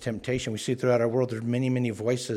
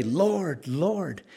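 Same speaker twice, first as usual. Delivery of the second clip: "Lord, Lord" a little higher, each falling; pleading double call; 5 semitones higher than usual. Retold or imitated. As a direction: cry "Lord, Lord" to get his attention. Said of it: "getting his attention".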